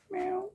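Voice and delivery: very high squeaky mock meow, soft; a quick cheeky little cat mew. Cat after swing a cat.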